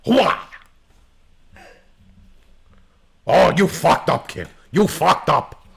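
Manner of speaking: loud, high gung-ho soldier shout; hammed-up bad Pacino yell. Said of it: "That's a terrible Al Pacino".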